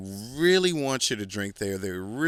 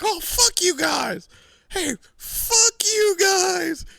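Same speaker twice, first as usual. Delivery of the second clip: put-on much higher, loud drunken shout, slow; drunk yelling; 7 dB louder, much slower than usual. Drunk Marine yelling at his friends, loud slurred shout.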